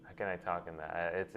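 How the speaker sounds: usual speaking voice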